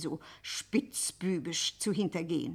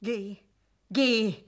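sharp dismissal; 6 dB louder than usual. Acted. Scuderi rejects pleading Madelon.

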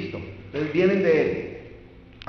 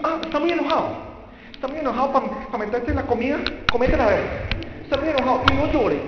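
high, taunting scold; mock a parent taunting a crying child; 7 semitones higher, faster than usual. Imitating a parent scolding an angry child, high and taunting. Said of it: "que le decían".

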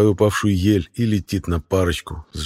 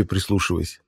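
usual speaking voice

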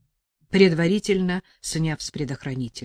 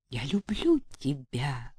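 usual speaking voice

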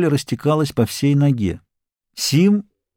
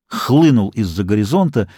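ordinary delivery

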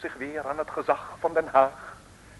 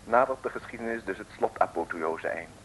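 pompous pulpit storyteller voice; mock sermon narration; 6 semitones lower, 3 dB quieter than usual. A narrator's reading. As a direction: address the audience like a preacher, then tell the tale softly.

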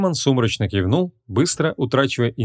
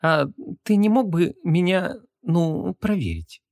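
shy request; 8 semitones higher, slower than usual. A narrator's reading. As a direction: ask hesitantly, fading at the end. (робкая женская просьба с запинкой)

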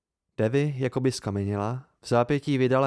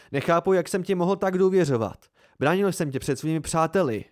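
raised, heated reproach; angry; 6 semitones higher, 3 dB louder than usual. A narrator's reading. Narrator feeling betrayed by Devi, angry. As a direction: accuse angrily with the pitch pushed up. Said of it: "vyštěkl jsem hněvivě".

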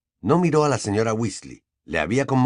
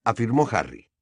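plain reading voice

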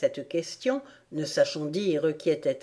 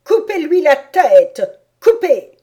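furious shout; 9 semitones higher, 12 dB louder than usual. A narrator's reading. Queen orders Alice beheaded, furious shout. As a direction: roar the order in a terrible rage. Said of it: "entra tout à coup dans une colère terrible".

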